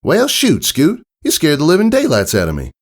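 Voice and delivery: high, startled country mare voice; a jolted farm pony catching her breath. Acted. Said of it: "Applejack said".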